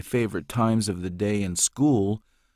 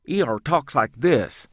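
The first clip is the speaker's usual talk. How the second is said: higher, louder quick clipped hillbilly; wiry hillbilly E R; 5 semitones higher, 4 dB louder than usual. Shown as a demonstration. Voice actor demos a wiry quick hillbilly character, clipped. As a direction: snap it out like a skinny sharp-witted cousin. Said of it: "He speaks more clipped".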